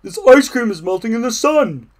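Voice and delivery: loud, high storybook dismay; alarmed Toad. A narrator's reading. Toad on the path as ice cream drips, alarmed. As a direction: exclaim as the treat starts to drip.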